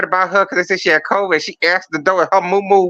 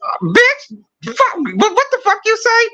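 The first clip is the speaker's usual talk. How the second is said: high mock shout of rage; hammed-up furious yell; 14 semitones higher than usual. [name] screaming back at [name], hammed-up high yell. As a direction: blow up at her like a cartoon villain.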